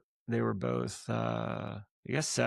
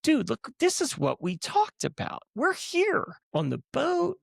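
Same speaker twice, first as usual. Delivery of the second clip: high-pitched impression of a thrilled buddy; imitated elation; 12 semitones higher than usual. Imitating a friend celebrating on the boat, high and excited. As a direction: voice your friend's giddy triumph, pitched way up.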